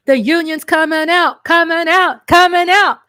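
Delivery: excited hype. Real, not acted. Host cheering union endorsements to viewers, excited hype. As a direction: build the hype with each repeat.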